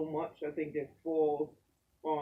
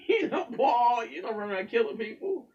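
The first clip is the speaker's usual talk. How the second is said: higher and louder, laughing through it; 8 semitones higher, 7 dB louder than usual. Real, not acted. Guest laughing about Paul killing people. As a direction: chuckle at the flawed apostle.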